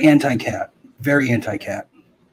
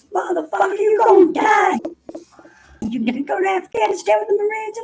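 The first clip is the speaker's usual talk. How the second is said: very high, hammed-up screaming; Foghorn Leghorn. Petty officer telling a recruit he'll die, high hammed-up yelling. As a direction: mimic an officer shrieking threats, stretching the words. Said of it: "he just starts yelling at me".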